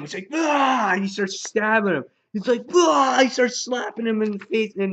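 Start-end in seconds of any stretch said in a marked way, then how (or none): 0.3-0.9 high, loud imitated stabbing cry; hammed-up "ah" of attack; mock attack yell. Imitating the gutting of Spencer. 2.7-3.2 loud, high-pitched imitated cry; hammed-up stabbing yell; mock attack yell.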